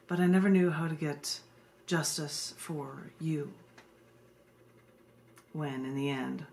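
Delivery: slow, low, solemn poetry reading; poem reading. Acted. Poet reading her justice poem to a live audience, solemn and low. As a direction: read it like a grave spoken-word poem.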